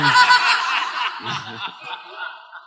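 sudden high laugh. Real, not acted. Pastor laughing at his own joke, high and bright. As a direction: burst out laughing. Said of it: "Ah, sorry".

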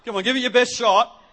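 loud, high mock challenge; teasing Jesus. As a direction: dare them playfully, raising your voice. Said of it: "I would have loved it if Jesus said".

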